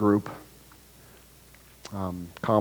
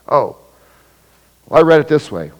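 louder, huffy mock reply; defensive retort; 4 semitones higher, 8 dB louder than usual. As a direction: snap back as if misreading a text. Acted text message quarrel.

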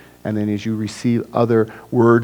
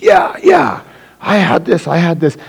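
high, loud and eager, yeah yeah; eager claim; 6 semitones higher, 5 dB louder than usual. Acted. Eager rival shouting that he had it first. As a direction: grab it first, all high excitement.

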